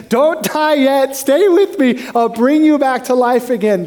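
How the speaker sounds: high, urgent plea, drawn out; acted urgent plea to hold on; pleading God. God hand-feeding Elijah, acted urgent high plea.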